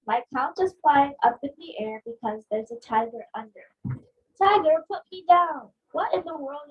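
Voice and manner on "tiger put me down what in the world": calling out. Acted. Lifted up on couch.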